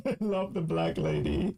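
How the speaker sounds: high, giggly reaction; amused laughing. Real, not acted. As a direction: say it through a real laugh.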